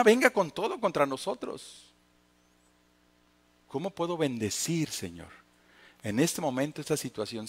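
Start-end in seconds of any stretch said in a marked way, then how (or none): 3.7-7.5 much softer, intimate plea; hushed prayer. Acted. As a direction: whisper it to God in the moment. Quiet inner prayer. (oración íntima y suave)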